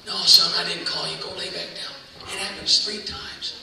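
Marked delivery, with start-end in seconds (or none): 0.1-1.9 sleepy Eli. Acted. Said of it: "He gets up".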